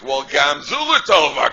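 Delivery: slow, loud, sing-song sarcasm; sarcastic. Retold or imitated. Reply to someone who banged their head, slow loud sarcasm. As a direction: rub it in with fake piety.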